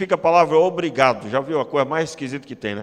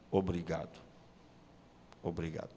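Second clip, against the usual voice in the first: low and much softer Obrigado; soft heartfelt thanks; 11 semitones lower, 17 dB quieter than usual. Shown as a demonstration. Pastor showing heartfelt thanks, soft and low. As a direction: murmur thanks as if moved.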